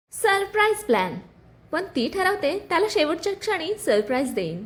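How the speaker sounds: ordinary delivery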